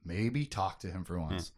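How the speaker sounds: low, hushed inner thought; soft, low musing. Retold or imitated. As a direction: think it quietly to yourself. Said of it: "now she's just thinking".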